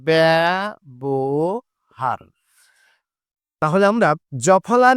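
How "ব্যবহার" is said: ব্যবহার stretched very slowly, the ব্য said "bæ"; drawn-out pronunciation demo. Shown as a demonstration. Teacher to students, stretching ব্যবহার very slowly. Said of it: "এই উচ্চারণটা কিন্তু ব্যয়ই করতে হবে".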